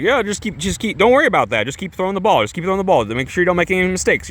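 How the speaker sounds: lightly acted insistent coach; urging coach. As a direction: rattle off encouragement like a pushy coach. Retelling coach urging player on.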